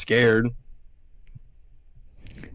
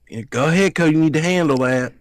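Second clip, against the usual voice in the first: higher, louder mocking Southern aunt; dramatic Southern aunt; 5 semitones higher, 4 dB louder than usual. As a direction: mimic her dramatic Southern goading. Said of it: "it was so fucking dramatic".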